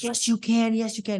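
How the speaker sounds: high-pitched, insistent urging; pushing coach. Retold or imitated. Film coach driving his exhausted player on, pushing urging. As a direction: drive him on like a coach, lightly acted. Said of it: "He's pushing him and he's driving him".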